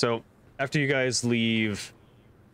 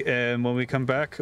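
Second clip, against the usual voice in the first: normal talking voice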